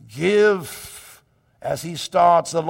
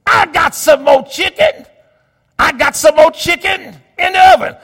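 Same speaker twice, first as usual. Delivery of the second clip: loud, high mother calling across the dinner table; be Mama hollering at her boy; mother scolding; 10 semitones higher, 12 dB louder than usual. Retold or imitated. Mother scolds Little Robert at the dinner table, loud and high. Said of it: "and my mother said".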